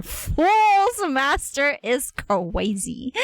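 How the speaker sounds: slow, high, drawn-out amazement; amazed exclamation. Real, not acted. Streamer reading the character's title, amazed exclamation. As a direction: stretch the first word way up.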